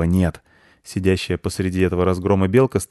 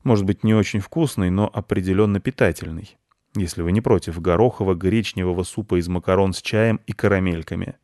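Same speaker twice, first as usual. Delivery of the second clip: ordinary delivery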